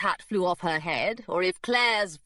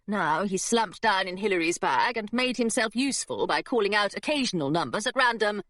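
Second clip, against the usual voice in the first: plain reading voice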